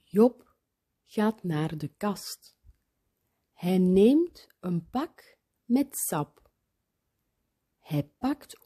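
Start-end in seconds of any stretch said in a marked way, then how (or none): none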